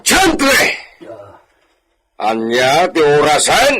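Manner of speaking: high, loud call for the bartender; hollering for the barman. Acted. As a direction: shout across the room. Drunk patron calling the bartender.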